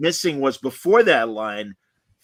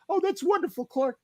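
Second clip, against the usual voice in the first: high, soft, hammed-up weepy mom; mock tearful mother; 13 semitones higher, 5 dB quieter than usual. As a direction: act a mom about to burst into fake tears. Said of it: "and then start crying".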